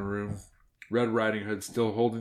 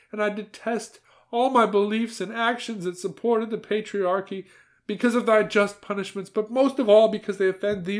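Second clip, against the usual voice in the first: put-on higher, louder pious old woman's voice; old woman praying; 11 semitones higher, 5 dB louder than usual. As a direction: recite it like a devout grandmother at confession. Grandmother's act of contrition.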